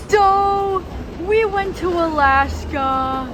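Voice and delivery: loud, high, drawn-out; excited. Real, not acted. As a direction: announce it with a big grin. Loud excited announcement on arrival.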